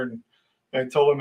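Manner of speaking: plain reading voice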